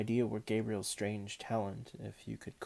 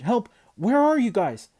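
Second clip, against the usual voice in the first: much higher, much louder panicked calling; shouted; 12 semitones higher, 11 dB louder than usual. A narrator's reading. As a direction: yell for your lost friends. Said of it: "he shouted".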